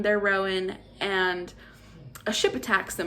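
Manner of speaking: ordinary delivery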